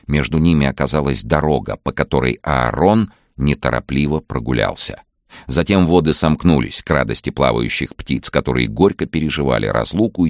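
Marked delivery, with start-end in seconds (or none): none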